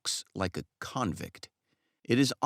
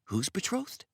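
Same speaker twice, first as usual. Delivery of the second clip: startled, much higher question; a frightened barber hearing bad news; in a fright; 14 semitones higher than usual. A narrator's reading. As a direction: blurt it out in sudden alarm. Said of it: "asks in a fright".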